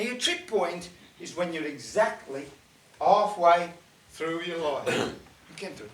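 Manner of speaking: plain reading voice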